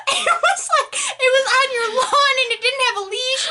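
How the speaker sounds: high, laughing, flustered excuse. Retold or imitated. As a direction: stammer out excuses while laughing. Flustered explaining to dog owner.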